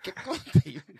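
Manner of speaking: usual speaking voice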